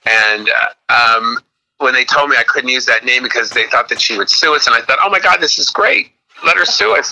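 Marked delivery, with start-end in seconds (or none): 5.0-7.1 retold delight. Retelling own delighted reaction to lawyers.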